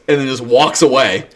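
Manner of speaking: ordinary talk, nothing marked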